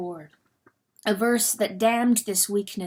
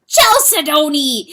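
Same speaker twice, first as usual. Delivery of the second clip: loud, higher anguished wail; wailing name; 6 semitones higher, 10 dB louder than usual. Acted. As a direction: cry the name in torment. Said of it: "he wailed".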